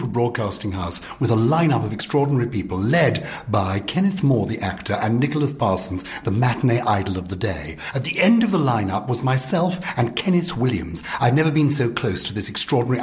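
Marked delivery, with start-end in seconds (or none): none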